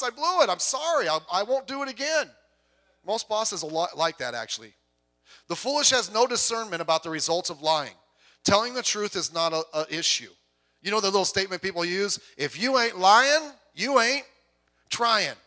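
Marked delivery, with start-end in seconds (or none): 0.0-2.3 higher and louder, eager owning up; mock confession to boss. Worker confessing a mistake to the boss, higher and louder. 12.4-15.3 slow, louder, swaggering slogan; mocking a cynical saying. Preacher mocking the saying people use, slow and louder.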